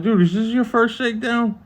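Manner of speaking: incredulous. Retold or imitated. Guard's incredulous question.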